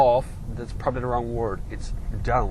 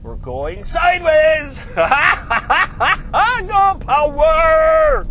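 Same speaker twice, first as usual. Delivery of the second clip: high, loud, stretched yell with laughter; exhilarated shouting; 14 semitones higher, 10 dB louder, much slower than usual. Real, not acted. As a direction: shout and laugh as the car drifts. Car drifts under power.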